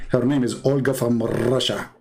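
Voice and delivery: ordinary talk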